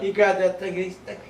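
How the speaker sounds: ordinary talk, nothing marked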